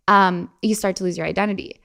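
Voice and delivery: normal talking voice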